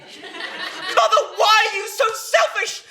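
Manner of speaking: high, drawn-out outburst; desperate reproach. Acted. Son at breaking point with his mother, high-pitched reproach. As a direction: cry out at the end of patience.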